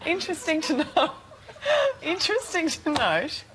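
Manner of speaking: giggling through the words; fighting back laughter on air; anchor stifling giggles. Real, not acted. Laughing, can't finish sentence.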